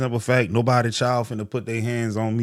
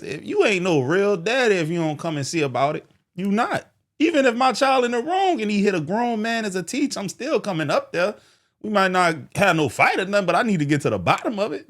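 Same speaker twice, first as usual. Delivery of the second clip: worked-up tough talk; 9 semitones higher than usual. Real, not acted. Hyped tough-guy rant.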